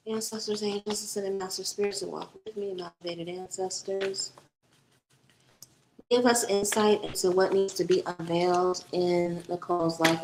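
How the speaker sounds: hushed, reverent invocation of ancestors and spirits. Acted. Invocation to ancestors before reading.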